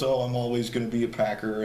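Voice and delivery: lightly imitating a tearful retirement speech; mock crying. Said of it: "you know, he cried".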